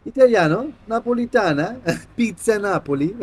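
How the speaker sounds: excited. Real, not acted. Reacting to a pulled card.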